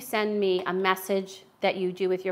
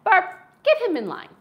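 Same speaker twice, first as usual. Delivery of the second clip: playful triumph; 10 semitones higher, 10 dB louder than usual. Real, not acted. Mock-rallying ally against partner.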